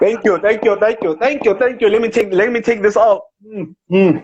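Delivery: laughing, dropping the character to thank the crowd. Acted. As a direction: bask in applause, laughing high. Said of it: "Boya Tia".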